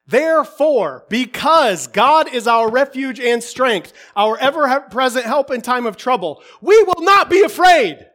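loud preaching that builds to a shout; rising, emphatic pulpit declaration; forceful preaching. Real, not acted. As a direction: hammer the promise home, ending in a shout.

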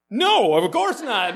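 Judy refusing the pastor's apology, loud mock anger; mock angry refusal. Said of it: "Judy says".

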